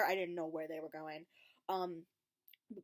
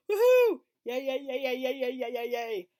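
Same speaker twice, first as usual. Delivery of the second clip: much higher and louder, chanting yay; loud whooping cheer; 4 semitones higher, 12 dB louder than usual. Retold or imitated. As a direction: celebrate at the top of your voice. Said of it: "So I'm like".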